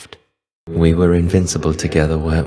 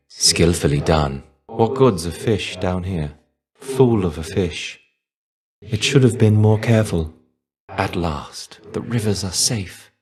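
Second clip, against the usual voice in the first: normal talking voice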